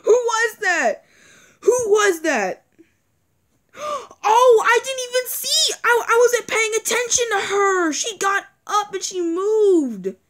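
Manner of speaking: high shaken disbelief. Real, not acted. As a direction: still rattled from the scare. Shaken after jumpscare.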